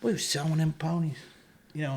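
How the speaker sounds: ordinary delivery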